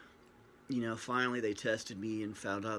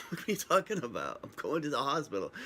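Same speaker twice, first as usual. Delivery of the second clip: higher and a little louder, half laughing in disbelief; laughing disbelief at being told to get dressed; incredulous; 4 semitones higher, 4 dB louder than usual. Retold or imitated. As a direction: toss it back as if she's crazy. Retorting to wife about clothes.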